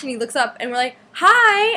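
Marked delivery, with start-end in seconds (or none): 1.1-1.7 put-on high, loud fan-girl hello; excited greeting. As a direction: call out an eager hi, pitched way up. Fans greet Josh in the street.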